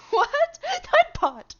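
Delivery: very high, loud laughing squeal; laughing shriek. Real, not acted. Streamer squealing with laughter at the chat bot. Bot keeps timing him out.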